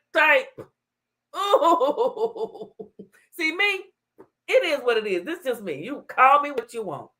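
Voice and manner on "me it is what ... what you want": normal talking voice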